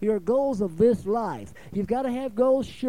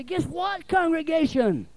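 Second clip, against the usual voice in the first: much higher, teasing the big news; eager announcement; 8 semitones higher, slower than usual. Retold or imitated. Preacher teasing his congregation with news. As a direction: build suspense for the church. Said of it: "I should get up and say".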